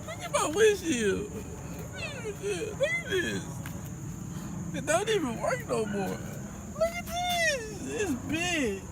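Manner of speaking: high, strained disbelief; showing viewers his smashed windshield. Real, not acted. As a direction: squeak it out in shock. Showing car damage in disbelief.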